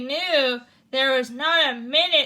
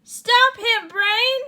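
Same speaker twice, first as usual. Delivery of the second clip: high, loud urgent plea; urgent cry; 10 semitones higher, 7 dB louder, slower than usual. Acted. Penny urging Brain to stop the agent, high and loud. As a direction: yell for help right now. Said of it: "she exclaimed".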